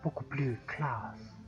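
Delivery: soft put-on Italian accent. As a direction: settle back into a mock Italian accent. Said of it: "je vais rester avec l'accent italien".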